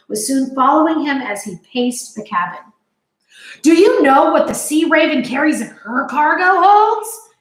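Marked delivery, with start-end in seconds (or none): 3.6-7.3 loud, higher bellow; captain bellowing at his visitors. A narrator's reading. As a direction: boom the question across the cabin. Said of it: "Captain Swain bellowed".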